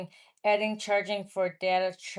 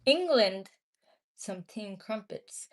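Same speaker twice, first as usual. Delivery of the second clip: mock British accent; slower than usual.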